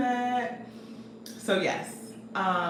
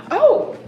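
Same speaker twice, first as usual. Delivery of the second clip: startled gasp; 11 dB louder than usual. Real, not acted. Realises she forgot goodies.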